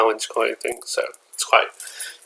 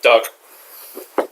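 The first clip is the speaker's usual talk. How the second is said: ordinary talk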